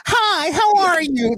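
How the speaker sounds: high, slow silly voice; squeaky put-on voice at the speaker; high silly drive-thru voice. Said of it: "Different voices and pull up".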